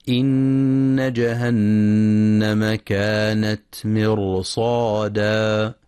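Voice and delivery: slow, measured tajweed chant; Quran recitation. Acted. Teacher reciting the verse to be studied, slow tajweed recitation. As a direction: recite slowly with drawn-out long vowels.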